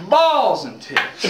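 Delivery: slow, high-pitched comic curse; exaggerated yelp of a curse; mock cursing. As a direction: drag the swear out as if you just hit your thumb. Father curses after whacking thumb.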